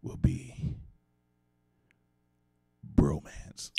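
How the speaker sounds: dramatic host whisper. Pretend. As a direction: whisper the word like a secret. Host whispers the final word.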